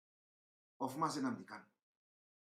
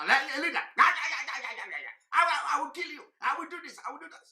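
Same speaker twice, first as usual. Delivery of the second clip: raised, higher mocking chant of a hothead; mock agitator shouting; 5 semitones higher, 4 dB louder, much faster than usual. Commentator mocking agitators chanting and threatening, in a raised mock yell. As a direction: hammer out the stammered chant and threats like a rowdy follower.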